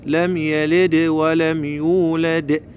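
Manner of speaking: slow recitation with echoed د in يلد and يولد; qalqala on dal. Shown as a demonstration. Teacher demonstrates the qalqala letter, both dals bounced. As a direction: recite drawn out, bouncing each final د. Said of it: "هذا حرف الدال من حروف القلقلة".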